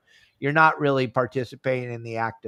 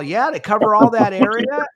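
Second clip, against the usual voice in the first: higher, louder mock sarcasm; sarcastic retold question; 6 semitones higher, 6 dB louder than usual. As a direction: needle him about covering so much water. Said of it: "And I'm all".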